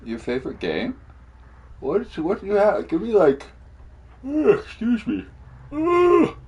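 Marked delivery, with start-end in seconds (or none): 1.8-6.3 words stretched through a big yawn. Real, not acted. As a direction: talk while yawning, pitch jumping up. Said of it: "Got that yawn out there".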